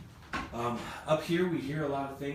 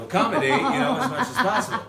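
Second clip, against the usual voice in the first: loud laughing through the words; 12 semitones higher, 11 dB louder than usual. Real, not acted. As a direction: laugh out the joke. Jokes about giving ghost alcohol.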